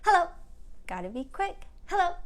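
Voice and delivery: snappy rapid hello; quick hello. Shown as a demonstration. Teacher shows the kids a fast hello. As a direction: get it out fast. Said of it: "Let's say hello as fast as we can".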